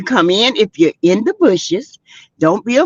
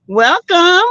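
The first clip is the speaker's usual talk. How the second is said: usual speaking voice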